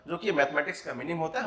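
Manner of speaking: ordinary talk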